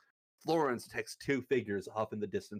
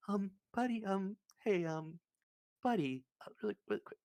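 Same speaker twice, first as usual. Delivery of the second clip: a higher, hesitant worried voice; concerned friend; 10 semitones higher, faster than usual. Acted. Satorvik checks on his drunk friend, hesitant and worried. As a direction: ask gently, unsure. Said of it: "Satorvik comes over and goes".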